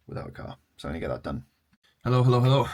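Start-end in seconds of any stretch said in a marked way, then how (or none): 2.0-2.7 loud greeting. Real, not acted. Loud vlog greeting to camera.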